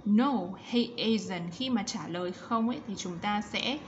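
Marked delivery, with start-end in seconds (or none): 0.1-1.5 slow, every word of "No, he isn't" spelled out; slow model answer. Shown as a demonstration. Teacher modelling the answer for pupils, slow and clear. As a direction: read "No, he isn't" slowly for the class. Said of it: "teacher models answer slowly".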